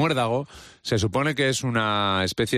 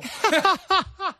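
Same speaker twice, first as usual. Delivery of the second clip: cracking up high; real laugh; high burst of laughter. Real, not acted. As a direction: laugh at your own impression. Laughs after impression.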